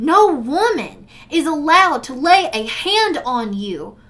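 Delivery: angry confrontation; retold confrontation. Confronting abuser in retold quote.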